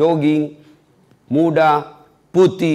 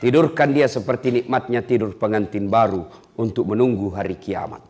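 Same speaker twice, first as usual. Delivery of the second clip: lower, softer, calm divine decree; 5 semitones lower, 5 dB quieter than usual. Acted. Allah decrees rest for the faithful, low and soft. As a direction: pronounce it low and gentle. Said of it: "Allah berfirman".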